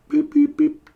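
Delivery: high imitated dropout beeps; phone beeps. Faking a call with bad signal, high beeps. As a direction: beep like a failing phone line. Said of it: "It's a bit of a bad signal".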